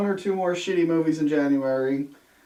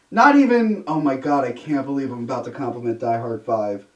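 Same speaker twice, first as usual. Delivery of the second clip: normal talking voice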